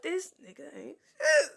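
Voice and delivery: tearful high outburst; crying out. Retold or imitated. Quoting herself crying over the boyfriend. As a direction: sob out the complaint, loud and high. Opening quote, crying loud.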